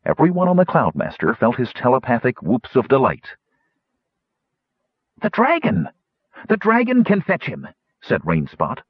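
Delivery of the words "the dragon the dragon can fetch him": high, eager exclamation; excited idea. A narrator's reading. Rainspot hits on a rescue for his friend, excited. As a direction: pipe up high with sudden hope.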